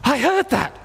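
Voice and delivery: high-pitched, startled exclamation; retold astonishment. Man hearing a finger click after prayer, astonished. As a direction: imitate his amazed reaction. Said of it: "He says".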